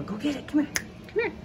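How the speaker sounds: high calling to the dog; excited pet talk. Real, not acted.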